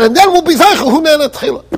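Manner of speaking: imitating the man's offhand tone; casual, offhand. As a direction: say it like it's nothing. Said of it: "like you take a cup of coffee".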